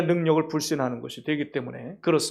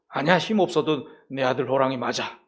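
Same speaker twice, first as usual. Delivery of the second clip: gentle father; 6 semitones lower, 4 dB quieter than usual. Acted. Father tiger reassuring the cub.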